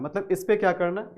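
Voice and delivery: normal talking voice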